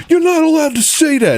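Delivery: very high squeaky mock whine; high-pitched parody of an outraged host; mocking Rosenberg. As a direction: squeal it like a tattling child. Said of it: "Rosenberg's like".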